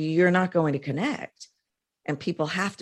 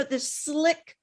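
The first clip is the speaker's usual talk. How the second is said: put-on polished speaker delivery; slick speaker voice; 11 semitones higher than usual. Shown as a demonstration. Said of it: "speaker voice".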